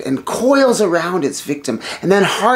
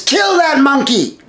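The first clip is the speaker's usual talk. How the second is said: higher, louder furious shout; enraged king ordering the kill; 6 semitones higher, 5 dB louder than usual. Acted. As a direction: bark the death order. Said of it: "Ravana gets furious".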